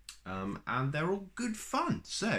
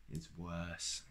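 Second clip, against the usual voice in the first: glum and much softer, low and deflated; unhappily; 6 semitones lower, 12 dB quieter than usual. Acted. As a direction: sigh it out, nothing's going right. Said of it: "said Mister Happy unhappily".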